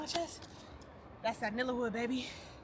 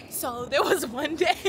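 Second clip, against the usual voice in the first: laughing start; 4 semitones higher, 10 dB louder, much slower than usual. Real, not acted.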